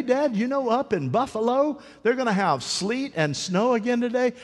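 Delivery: put-on high, eager boy voice; excited little boy. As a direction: chatter like a kid thrilled about snow. Boy voiced excitedly telling dad weather.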